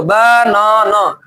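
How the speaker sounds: Banana slow, in three stretched pieces; broken into syllables. Shown as a demonstration. Teacher contrasting with one-beat word, banana split by syllable. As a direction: hold each syllable, ब... ना... ना. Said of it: "तीन तोड़ में तोड़े".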